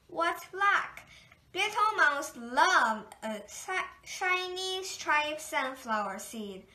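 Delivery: ordinary delivery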